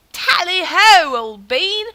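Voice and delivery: overdone posh British greeting, drawn out and loud; botched stab at a British gent; bad British accent. A gamer greeting Sir Victor in a bad British accent. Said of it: "That was horrible".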